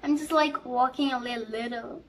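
usual speaking voice

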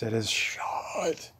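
slow, worn-out voice; strained voice. Real, not acted. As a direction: speak through a strained voice. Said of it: "just murdered my voice".

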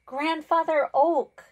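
loud, high call, drawn out. A narrator's reading. Pond spirit calling out to Grandfather Oak for forgiveness, loud and high. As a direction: call across the pond to reach him. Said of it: "he called".

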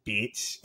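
"bitch" drawn out for emphasis; emphatic. Retold or imitated. Retelling a cast member's threat, emphatic on "bitch". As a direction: lean on "bitch", stretching the word out. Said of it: "She put the emphasis on it".

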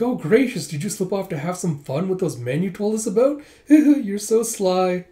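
high, coy scandalized tease with a giggle; teasing gossip. Acted. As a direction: tease slyly, giggle, then stretch the last words. Attendant teases her lady, giggling.

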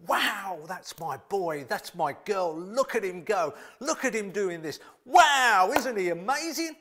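high, loud proud-parent cheer; God cheering. Pretend. Preacher voicing God cheering on His children, proud and loud. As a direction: cheer like a thrilled father watching his child. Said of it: "Do you know God is actually".

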